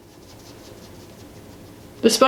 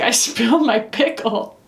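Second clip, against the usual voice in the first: put-on small child voice broken by a laugh; lightly voiced toddler, laughing through it; laughing toddler imitation. Voicing toddler son.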